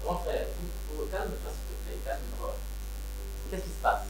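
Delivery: softly hushed, calming a crying brother; soothing quiet. Acted. Calming his crying big brother on the phone, softly soothing.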